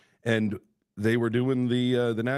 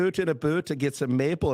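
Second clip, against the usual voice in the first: higher-pitched cartoon Canadian "oot and a boot"; mock Canadian; 7 semitones higher than usual. Host joins the about-off, mock Canadian accent. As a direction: ham up the Canadian vowels, pitched up. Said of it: "let's have an about off".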